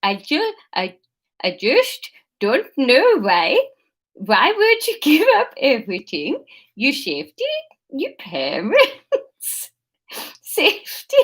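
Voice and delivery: put-on halting, overdone Dutch accent; bad Dutch accent. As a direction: stumble through it in a Dutch accent. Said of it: "integral to my Dutch accent".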